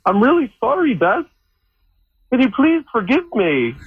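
high-pitched, badly faked British accent; fake British accent. Shown as a demonstration. Employee begs Beth's forgiveness in a fake British accent. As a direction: apologise in a put-on British accent. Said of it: "[name], just give us a little".

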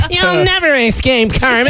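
hammy silent film villain; mustache twirling villain. Pretend. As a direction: gloat like a melodrama baddie. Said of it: "I'm gonna twirl my mustache".

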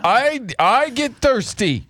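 exaggerated slow-talker impression; mimicking co-worker. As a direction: drag each word out in mockery. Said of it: "That's how you sound".

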